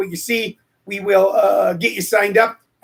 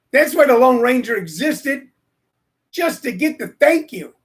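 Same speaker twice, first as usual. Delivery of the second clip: normal talking voice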